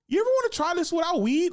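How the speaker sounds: high, loud, quick mock question; pointed suggestion. Acted challenge to smoking comics.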